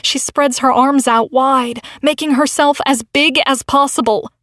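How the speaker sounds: ordinary delivery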